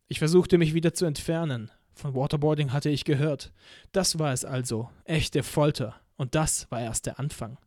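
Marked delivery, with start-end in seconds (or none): none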